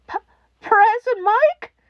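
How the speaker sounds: small voice through tears; tearful child. A narrator's reading. Frightened child calls for the radio host, crying.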